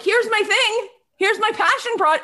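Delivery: lightly hammed-up sunny pitch; mock cheery pitch. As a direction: parody an eager product pitch. Friend mocks a cheery pitch.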